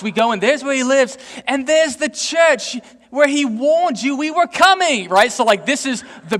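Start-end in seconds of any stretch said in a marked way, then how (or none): 0.0-5.1 put-on British accent. British woman guiding through Revere's house, British accent. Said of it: "she was a British woman".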